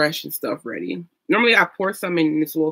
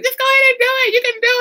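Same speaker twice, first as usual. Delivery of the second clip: high, coaxing mock mom voice, much higher; imitating mom; 5 dB louder, faster than usual. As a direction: play mom cheering her on, pitched way up. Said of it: "My mom's like".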